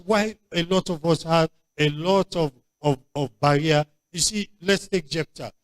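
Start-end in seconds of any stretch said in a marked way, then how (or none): none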